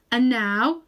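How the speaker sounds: loud, slow, majestic lion's voice; strong, happy voice. A narrator's reading. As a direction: proclaim it grandly like a king. Aslan speaks.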